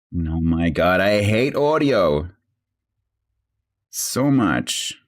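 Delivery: slow, loud exasperated groan. Real, not acted. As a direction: drag it out in frustration. Exasperated rant about audio.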